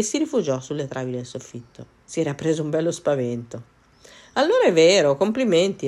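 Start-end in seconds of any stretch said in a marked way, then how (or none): 4.3-5.9 high, loud burst of admiration; exclaiming. A narrator's reading. Cat to the ogre after his lion trick, exclaiming. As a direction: exclaim it, impressed and recovering from a fright. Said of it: "esclamò non appena si fu ripreso".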